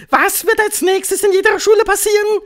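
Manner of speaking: very high, loud witch shout; piercing commanding cry; calling over noise. A narrator's reading. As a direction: yell it over the din. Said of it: "rief die Hoch- und Großmeisterhexe in den Lärm".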